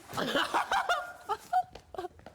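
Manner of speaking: shrill laugh while tickled; high burst of laughter. Real, not acted.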